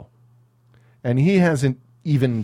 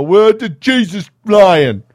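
loud, high, drawn-out hammed-up voice; over-the-top mock proclamation; ham-fisted; 7 semitones higher, 9 dB louder, slower than usual. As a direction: blare it out with no subtlety. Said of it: "If it's this ham-fisted".

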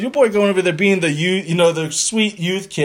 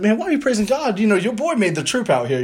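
much higher, offended disbelief; a proud teen, miffed they thank God instead; indignant inner voice; 6 semitones higher than usual. Retold or imitated. Proud teen thinks at thankful family, indignant and pitched up. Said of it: "I was like".

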